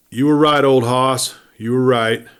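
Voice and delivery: put-on gruff mutter to an absent old friend; grumbled. A narrator's reading. Freezing man grumbles to the old timer. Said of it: "the man grumbled".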